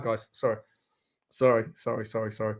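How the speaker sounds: low, soft string of sorries; hushed apology. Real, not acted. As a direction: drop right down, sheepishly keeping the noise down. Apologises for being too loud.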